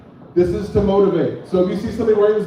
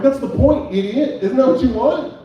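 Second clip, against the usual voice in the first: exasperated retort; 7 semitones higher than usual. Retold or imitated. Comedian to roommate about muscle mommy date, exasperated high retort. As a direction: throw it at him in disbelief.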